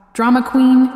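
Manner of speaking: loud, high scornful jab; scoffing. Acted. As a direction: sneer it dismissively. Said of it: "Felix scoffed".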